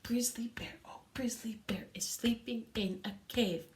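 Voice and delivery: soft whisper to start the crescendo; whispered opening of the crescendo; piano whisper. Shown as a demonstration. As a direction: barely breathe it. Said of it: "ready, piano".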